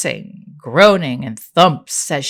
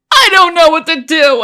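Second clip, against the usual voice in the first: panicked shout; 13 semitones higher, 11 dB louder than usual. Acted. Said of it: "I sniffled".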